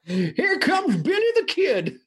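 put-on high-pitched crazy old prospector. Prospector announcing Billy the Kid's showdown, high old-timer voice. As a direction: hoot it like an excited Old West coot. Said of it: "It's the old prospector".

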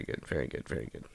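very soft, low whisper. Real, not acted. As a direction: fade to a hushed whisper. Trailing comment on King Kong.

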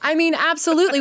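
laughing, pitched well up, agreeing heartily; laughing agreement. Real, not acted. Answering the host's teasing, laughing agreement. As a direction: laugh it off, then agree emphatically.

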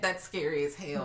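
ordinary talk

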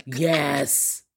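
normal talking voice

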